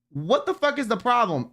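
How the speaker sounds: ordinary talk, nothing marked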